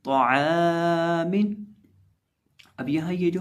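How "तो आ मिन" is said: तो आ मिन chanted very slowly, heavy तो; drawn-out recitation. Shown as a demonstration. Teacher joins the spelled letters for students, slow chant. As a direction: hold every vowel long as in tilawat. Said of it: "मीम दो देर मिन".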